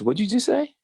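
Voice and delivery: stunned question. Retold or imitated. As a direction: fire it back in shocked disbelief. Student stunned by teacher.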